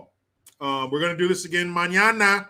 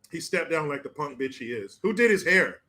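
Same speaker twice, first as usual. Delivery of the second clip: usual speaking voice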